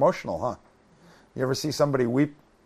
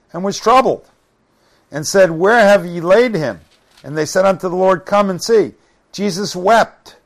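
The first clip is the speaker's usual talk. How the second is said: raised, louder Bible-reading voice; scripture reading; 5 semitones higher, 7 dB louder than usual. Acted. Preacher reads of Jesus seeing Mary weep, raised scripture reading. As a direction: read it out with weight on each phrase.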